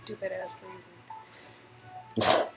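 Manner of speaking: normal talking voice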